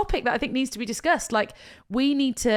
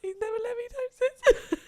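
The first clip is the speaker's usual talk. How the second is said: very high, faint tearful squeak; crying quote; 13 semitones higher, 4 dB quieter, faster than usual. Retold or imitated. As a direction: trail into tears retelling the speech. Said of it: "I feel like we're crying".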